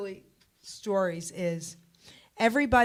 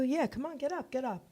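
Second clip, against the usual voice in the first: higher-pitched, softer nudge; coaxing awake; 6 semitones higher, 4 dB quieter than usual. Retold or imitated. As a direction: gently prod a sleeper to get up. Coaxing sleeping cousin out of bed.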